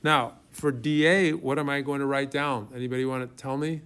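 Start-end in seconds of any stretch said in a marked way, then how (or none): none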